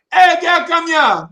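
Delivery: high, loud holler across a distance; calling out. Retold or imitated. Friend hailing far-off girls, high hollered call. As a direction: imitate hollering to someone far away.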